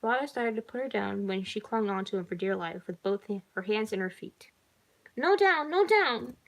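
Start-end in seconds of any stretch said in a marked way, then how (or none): 5.2-6.4 put-on high, loud little-girl protest; child's cry. A narrator's reading. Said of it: "she cried".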